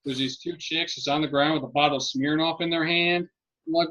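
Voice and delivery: ordinary delivery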